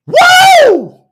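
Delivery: very high, held "woo"; excited whoop. Real, not acted. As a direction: whoop with church fervour. Whoops mid-sermon after hallelujah.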